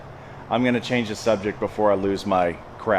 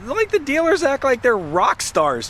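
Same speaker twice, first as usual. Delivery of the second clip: heated rant; 14 semitones higher than usual. Real, not acted. Ranting about arrogant dealers.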